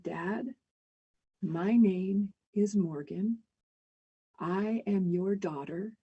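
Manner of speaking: slow, soft and steady comfort; calm him gently, word by word; reassuring. Retold or imitated. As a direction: daughter soothing a panicked father, slow and soft. Calming confused father at kitchen.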